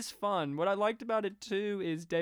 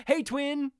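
higher, louder cheery yell; mock call-out; 8 semitones higher, 5 dB louder than usual. John Goodman calling out to the twins, higher and louder. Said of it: "points at them and goes".

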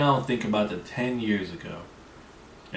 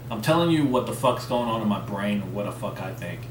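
normal talking voice

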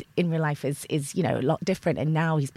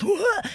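high, swooping mock-startled whoa; high-pitched jolt of alarm, played for laughs. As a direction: let a whoa leap up as if the whole body jolts. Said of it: "your whole body goes".

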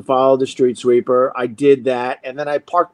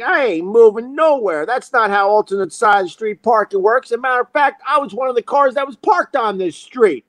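mock defiance; 7 semitones higher than usual. Acted quarrel over a spot.

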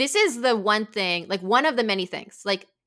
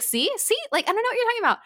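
mock defensive boyfriend; 13 semitones higher, 3 dB louder, much faster than usual. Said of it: "And then he's like".

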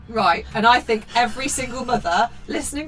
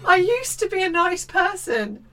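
higher, worn-out sigh of a line; weary mum lament; 9 semitones higher than usual. Retold or imitated. Mother echoing the line about herself, weary. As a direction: say it like every tired mother. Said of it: "This is what I think to myself".

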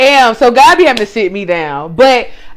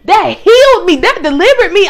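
higher and louder, triumphant declaration; excited; 8 semitones higher, 6 dB louder than usual. Real, not acted. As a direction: proclaim it with joyful release. Joyful testimony.

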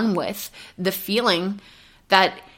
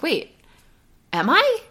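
much higher, startled double-take; sudden self-check; 9 semitones higher than usual. Retold or imitated. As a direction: catch yourself mid-thought, surprised. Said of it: "And I was like".

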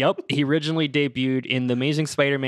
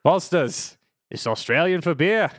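put-on Australian accent, slightly higher; 4 semitones higher than usual. Shown as a demonstration. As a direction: say it like an Aussie beer slogan. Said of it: "can you give me a quick".

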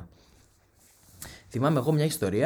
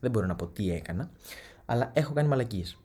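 ordinary talk

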